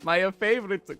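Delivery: high, loud, slow plumber wail with laughs; Mario impression. Streamer obliges chat's request as a high, loud panicked Mario. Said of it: "Do an impression of Mario".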